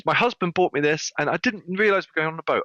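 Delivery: high-pitched imitation of a complaining guest; upset client. Retold upset client explains.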